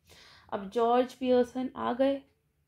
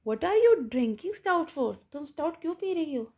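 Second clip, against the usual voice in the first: raised, astonished question; astonished husband, a little higher and louder; amazed; 5 semitones higher, 3 dB louder than usual. Acted. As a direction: ask it as if you can't believe it. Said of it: "amazed होते हैं".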